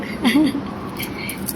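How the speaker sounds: soft voiceless laugh under the breath; quiet laugh. Real, not acted. Amused at the children's refusal, soft voiceless laugh. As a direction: let out a hushed airy chuckle.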